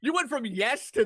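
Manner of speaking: loud, high disbelief; incredulous. Real, not acted. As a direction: call out the player's cheek. Amused disbelief at player's haggling.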